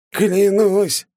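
громкий высокий выкрик, брызжа слюной; выкрикнуть клятву в агонии; under torture. A narrator's reading. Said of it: "брызжа слюной". (клятва под пыткой)

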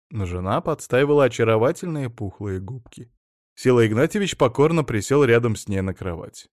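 plain reading voice

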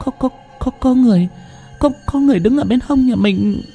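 high, stammering and halting with fear; broken frightened voice. A narrator's reading. Wife clinging to husband, stammering high with fear. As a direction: stutter out each word in terror. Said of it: "nói bằng giọng dứt quãng".

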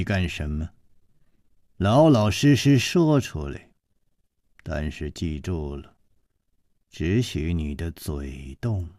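low, soft, icy threat; a woman's hushed deadly warning to a crouching man; cold and hard. Acted. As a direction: menace him quietly, cold as steel. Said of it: "这个声音接着说".

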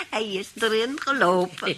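normal talking voice

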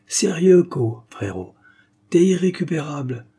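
loud, high, laughing jab; boisterous outburst. A narrator's reading. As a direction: blurt it out at your hopeless buddy. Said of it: "éructa Yacine".

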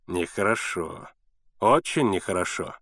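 understated low reproach; reproachful. A narrator's reading. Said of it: "укоризненно сказал лодочник".

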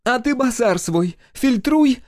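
high, drawn-out snap back; indignant woman in a shouting match; angry retort. A narrator's reading. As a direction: throw it back at him, pitch up. Woman snaps back in quarrel.

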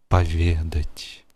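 plain reading voice

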